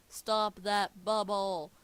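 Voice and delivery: drawn-out shouted "Stop that bubble!"; quoted catchphrase. Acted. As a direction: bellow it slowly as the famous line. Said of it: "I get the reference".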